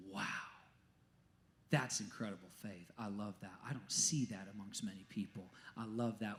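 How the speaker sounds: low, hushed amazement; jaw-dropped wonder. Retold or imitated. Jesus impressed by rare faith, in hushed wonder. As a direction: voice quiet awe, as if marveling. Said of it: "make his jaw drop 'cause he's like".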